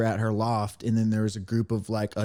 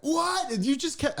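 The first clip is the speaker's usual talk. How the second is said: surprised; 14 semitones higher than usual. Retold or imitated. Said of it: "they were like".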